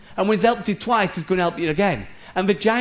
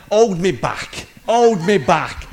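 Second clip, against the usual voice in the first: louder, slowing on the second hold me back; mock tough guy; 6 dB louder, slower than usual. Preacher mocking men who puff up before a fight, loud mock bravado. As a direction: ham up a posturing brawler who doesn't want to fight. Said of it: "it's almost like".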